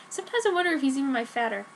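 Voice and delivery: plain reading voice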